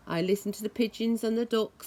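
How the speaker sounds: ordinary delivery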